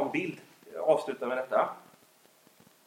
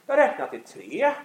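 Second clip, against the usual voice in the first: counting to three; 6 semitones lower, 3 dB louder, much slower than usual. Retold or imitated.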